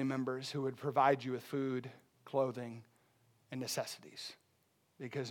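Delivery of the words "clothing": normal talking voice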